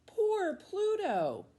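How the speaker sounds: slow, high wailing cry. Acted. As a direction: wail it out, high and drawn out. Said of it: "We cried".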